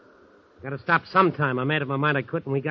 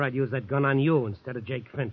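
usual speaking voice

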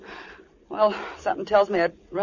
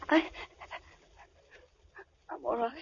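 small, soft voice after crying; halting and hushed, pulling yourself out of tears; shaken, tearful; 3 semitones higher, 10 dB quieter than usual. Acted. Crying girl assures her captor she is all right, shaken. Told to stop crying, collects herself.

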